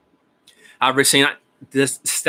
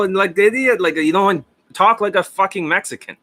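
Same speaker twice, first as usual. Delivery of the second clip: usual speaking voice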